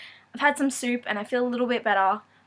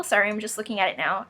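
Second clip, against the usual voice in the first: ordinary talk, nothing marked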